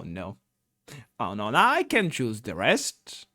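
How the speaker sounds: usual speaking voice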